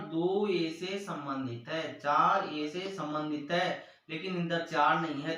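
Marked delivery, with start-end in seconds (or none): 0.1-1.9 "दो ए से संबंधित है" spoken slowly, word by word; slow read-aloud. Shown as a demonstration. Teacher reads the symbol aloud to students, slowly. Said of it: "इसको पढ़ते हैं इस प्रकार". 2.0-3.8 "चार ए से संबंधित है" spoken slowly, word by word; slow read-aloud. Shown as a demonstration. Teacher reads the symbol aloud to students, slowly. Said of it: "इसको पढ़ते हैं इस प्रकार".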